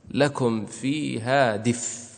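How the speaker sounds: يقف على "دف" بسكون خالص بلا همزة، ببطء; قل "لكم فيها دف" بطيئا ساكن الفاء; pause with sukun. Shown as a demonstration. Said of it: "فكيف تقف؟". (الوقف على "دف" بالسكون المحض ببطء)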